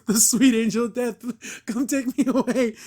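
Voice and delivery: high, drawn-out mock dying plea; mock melodrama. Imitating the clip's melodramatic plea.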